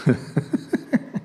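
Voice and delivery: quiet amused chuckle; a little laugh at himself; soft breathy laugh. Real, not acted. Amused by the verse.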